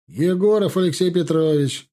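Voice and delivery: a happy, announcing shout; cheerful shout. Acted. Calling his name through the door to the guard, cheerful shout. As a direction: call out merrily through the door. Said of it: "крикнул он весело".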